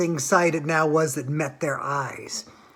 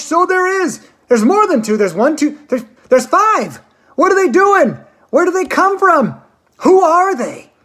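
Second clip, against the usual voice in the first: much higher and louder, tumbling cries; excited shouting; 10 semitones higher, 6 dB louder than usual. A narrator's reading. Visitors shouting to each other at the riverbank. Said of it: "everybody started shouting at once".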